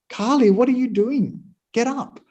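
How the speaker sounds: high-pitched mock scolding from the mistress. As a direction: play the irritated mistress waking her maid, pitched high. Mistress scolds the sleeping maid.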